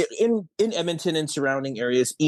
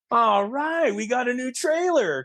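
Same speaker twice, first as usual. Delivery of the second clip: retold delight; 8 semitones higher than usual. Director thinking the crew got a new trailer, delighted. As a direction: replay happy excitement in a raised pitch.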